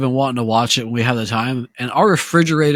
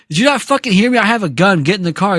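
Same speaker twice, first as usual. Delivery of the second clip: higher, mock menacing thug demand; threatening thug; 7 semitones higher, faster than usual. Retelling the thug's threat, higher and mock menacing. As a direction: bark it like a thug with a gun. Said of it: "He's like".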